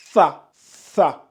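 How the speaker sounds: "Sa" with the tongue between the incisors, like English th in thin; lisped, interdental s in "Sa"; tongue-between-teeth sa. Shown as a demonstration. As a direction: bite the tongue lightly while saying "Sa". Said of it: "mais en mordant la langue".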